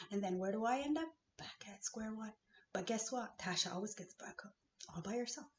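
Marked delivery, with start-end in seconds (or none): 1.4-2.3 whisper. Real, not acted. Bitter aside about setbacks.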